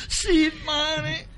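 put-on high coy girlish voice; girl's voice. Young woman confessing to priest, high coy voice. Girl voice, crying sound.

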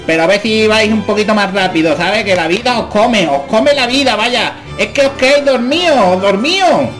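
loud, high mock rant; exasperated shouting. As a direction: rise to a frustrated yell.

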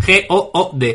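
slow letter-by-letter spelling; spelling it out slowly. Shown as a demonstration. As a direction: spell each letter out clearly. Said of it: "Oh My Goods".

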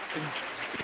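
real laughter between words; laughing. Real, not acted. Speaker laughs at the one who always falls, real laughter. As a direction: laugh along at the guy who fell.

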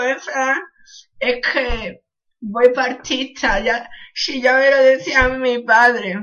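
normal talking voice

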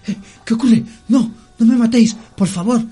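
high, loud, frightened begging; panicked pleading. Acted. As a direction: beg for your life, just woken and terrified. Captive wakes, begs not killed.

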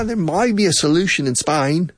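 playful imitation of Australian English; Australian accent. Teacher mocking Australian English with a light Aussie lilt. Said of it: "That's perfect Australian English".

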